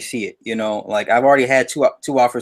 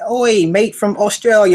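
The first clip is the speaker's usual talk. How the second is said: slow, higher mock Australian accent; 9 semitones higher, 4 dB louder, slower than usual. Reading a viewer's message from Australia aloud, in a mock Australian accent. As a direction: read the fan's greeting in a playful Australian accent. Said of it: "I'm reading the shit in their accent".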